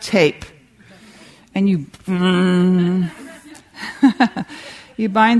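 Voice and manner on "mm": long drawn-out "mmm" noise; imitated taping noise. Speaker mimes taping a critic's mouth shut, drawn-out hum. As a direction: make a drawn-out taping sound effect.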